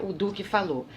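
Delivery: ordinary delivery, nothing marked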